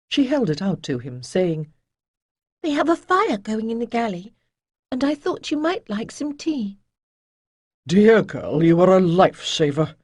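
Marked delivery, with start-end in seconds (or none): 2.6-6.8 put-on young girl. A narrator's reading. Young Ariel offers tea. 7.9-10.0 louder, lightly voiced old master; old sage Chayden. A narrator's reading. Chayden thanks Ariel.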